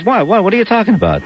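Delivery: stammered, retold show of innocence; mock innocent bafflement. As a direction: play the husband denying everything, quickly. Husband feigning innocence to raging wife.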